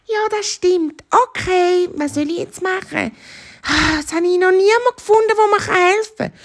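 high, plaintive hare voice; little hare, at a loss. Acted. Hare finds nobody to help, high and plaintive. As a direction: sigh it out as a small helpless hare.

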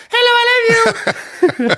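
slow high stage parody. Pretend. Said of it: "Einfach nur rauskommen und sagen".

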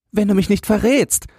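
put-on very high, eager child's voice; hasty boy. A narrator's reading. Boy stops the departing man, hasty. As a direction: blurt it before the man leaves. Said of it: "sagte Jürgen da schnell".

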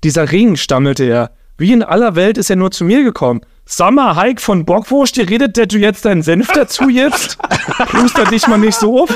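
theatrical parody audiobook in Berlin dialect; Berlin-dialect story reading. Acted. Host reads a rewritten Lord of the Rings parody, Berlin-dialect story reading. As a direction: read it out in broad Berlin dialect, drawing out phrases.

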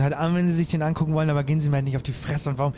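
fast, rising, agitated rant; frantic rant. Acted. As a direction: blurt it out, panicking. Passagier verliert die Geduld. (hektisch und aufgebracht)

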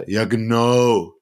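very slow, stretched sarcastic agreement; drawn-out mock ja genau. Imitating coworker Robert's sarcastic reply: slow drawn-out ja genau. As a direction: drag it out like a bored coworker. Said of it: "du sagst ihm was".

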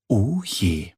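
ordinary delivery

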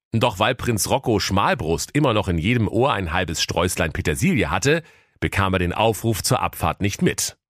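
ordinary delivery

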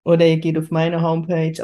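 normal talking voice

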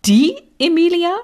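high, loud, rising disbelief; incredulous question. A narrator's reading. Mother raising an eyebrow about Emilia, incredulous. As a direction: pitch it way up in astonishment.

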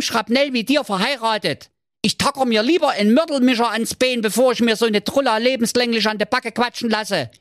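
put-on high, indignant young man ranting; snapping at princess. Marco snaps at the princess, fed up and high. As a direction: let it all out in a fed-up rant. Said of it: "blaffte die Prinzessin an".

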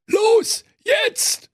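shrieking go signal; bear's yell. Acted. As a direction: yell fire now. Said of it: "der böse Bär rief".

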